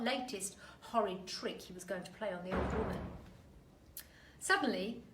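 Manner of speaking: usual speaking voice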